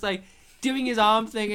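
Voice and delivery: usual speaking voice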